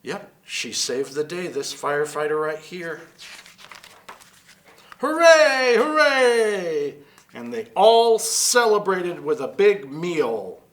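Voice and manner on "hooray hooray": high, loud hooray cheer. Acted. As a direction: cheer it out like a crowd. Celebrating the rescue.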